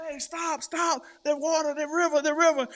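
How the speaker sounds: high shouted warning call; hollering warning. Retold or imitated. Dreamer warning a man on a buggy, shouting. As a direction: yell to stop him before the river. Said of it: "I'm hollering".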